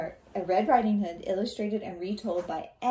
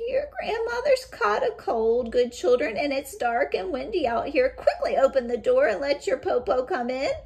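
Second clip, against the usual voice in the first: much higher, louder fake grandmother voice; cunning wolf as granny; 7 semitones higher, 9 dB louder than usual. Disguised wolf persuading the children to open, high and coaxing. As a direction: sweet-talk them into opening the door. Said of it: "the cunning wolf said".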